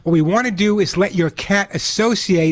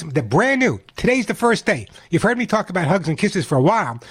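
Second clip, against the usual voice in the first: usual speaking voice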